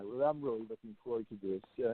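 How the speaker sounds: faint, distant voice off the phone; speaking too far from the mic; phone held away. Real, not acted. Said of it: "Oh, you're really low".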